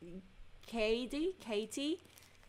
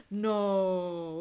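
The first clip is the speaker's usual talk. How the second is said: surprised gasp. Real, not acted. The paint shrunk in its pan.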